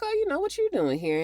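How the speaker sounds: quick, high, mock-sweet hello; mama's fast high greeting. Mother greeting her son's visitor in a quick, high mock-sweet voice. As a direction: rattle it off high and sugary. Retelling a TV plot, mama greeting.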